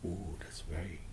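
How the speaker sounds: hushed whisper of wonder; soft whispered amazement. Shown as a demonstration. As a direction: breathe it in awe.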